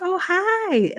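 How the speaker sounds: very high, singsong phone hello; cheery greeting. Retold or imitated. Caller greeting her teacher's wife on the phone, cheery. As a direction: imitate a gushing sweet greeting. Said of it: "Like I always do".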